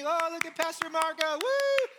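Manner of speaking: high, loud hammed-up cheer; mock cheering fan. Congregant cheering the pastor, hammed-up mock whoop. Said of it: "Prayer is not for the applause of the many".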